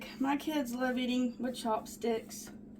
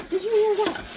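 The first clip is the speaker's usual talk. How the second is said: high, louder eager question; excited; 9 semitones higher, 6 dB louder than usual. Real, not acted. Asking viewers after the cake drops, excitedly. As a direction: ask it breathless with delight. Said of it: "I got excited".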